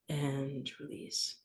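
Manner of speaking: drawn-out, hushed release; slow soft release cue. Shown as a demonstration. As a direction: let it go softly and slowly.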